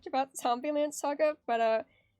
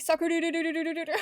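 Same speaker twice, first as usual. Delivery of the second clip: Sakura breaking into rapid-fire du-du-du; fast stuttered syllables like a skipping remix; playful remix stutter; 3 semitones higher, much faster than usual. Pretend. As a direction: rattle off the repeats quickly, then laugh. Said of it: "Sakura remix".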